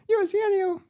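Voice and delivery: exaggerated squeaky imitation of her; over-the-top high mimicry; mock high voice. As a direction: pitch it way up, mocking. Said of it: "She doesn't talk like that either".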